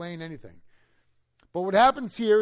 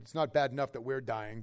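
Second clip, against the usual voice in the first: normal talking voice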